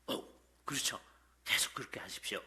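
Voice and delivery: soft, whispered approval to a new believer; hushed encouragement. Retold or imitated. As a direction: murmur it gently, like cheering someone on. Pastor voices church members' encouragement. (속삭이듯 다정한 격려)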